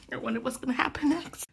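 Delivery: quick, unvoiced whisper; a quick conspiratorial whisper to the viewers. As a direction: tease the next chapter in a fast whisper. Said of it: "whispered teaser".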